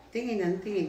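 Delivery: half-playful exasperation at his staring; exasperated complaint. Retold or imitated.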